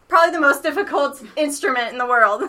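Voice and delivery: laughing through the words. Real, not acted. Laughing about banjo difficulty.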